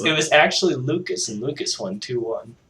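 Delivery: ordinary talk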